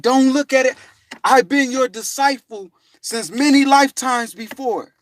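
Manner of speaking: high, loud devoted plea; earnest insistence. Retold or imitated. Dad claiming to be his disciple, earnest and loud.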